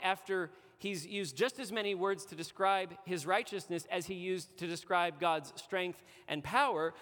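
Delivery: ordinary delivery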